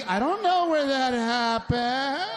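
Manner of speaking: high, slow, innocent wife voice; mock innocent wife. Mocking his wife's innocent denial about the wrecked car. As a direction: play dumb, high and drawn out.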